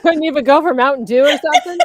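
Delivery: fast, loud, laughing through it. Real, not acted. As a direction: blurt it out while cracking up. Teasing laugh at tea order.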